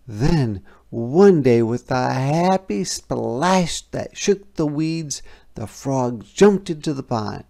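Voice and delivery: ordinary delivery, nothing marked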